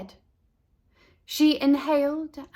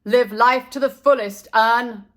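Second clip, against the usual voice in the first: calling out; 8 dB louder than usual. A narrator's reading. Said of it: "zoomed out to work and called".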